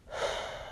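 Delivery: short, very quiet exhale; soft sigh. Real, not acted. Recalling the son's phone request, soft short sigh. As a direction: sigh softly before going on. Pause in a sad memory.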